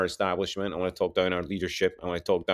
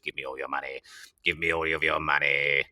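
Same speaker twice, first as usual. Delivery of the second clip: Green Goblin; 7 semitones lower than usual. Acted. Madman taking over the show, low sinister villain voice. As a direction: stretch it into a low menacing demand. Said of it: "take over like the Green Goblin".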